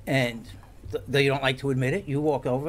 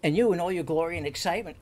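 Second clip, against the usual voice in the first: ordinary talk, nothing marked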